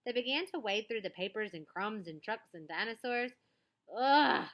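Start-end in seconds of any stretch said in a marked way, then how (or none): 4.0-4.5 a loud, sharp "Ugh" of fright; startled scream. A narrator's reading. Girl faces a giant lizard, startled loud cry. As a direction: jolt back, shout it out. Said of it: "screamed Annabelle".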